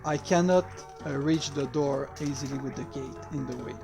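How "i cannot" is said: usual speaking voice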